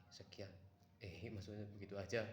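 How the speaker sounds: ordinary talk, nothing marked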